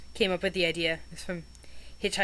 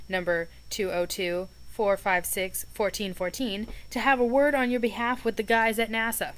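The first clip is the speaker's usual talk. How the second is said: reading aloud; 3 semitones higher, 4 dB louder than usual. A narrator's reading. Reads the list aloud.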